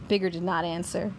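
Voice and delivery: usual speaking voice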